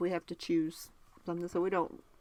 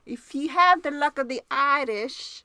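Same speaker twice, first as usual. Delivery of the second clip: higher, louder stab at an Irish accent; attempted Irish accent; 6 semitones higher, 6 dB louder than usual. Shown as a demonstration. Host retrying an Irish greeting for her co-host, bad Irish accent. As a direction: another go at a bad Irish lilt. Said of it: "Let me try again".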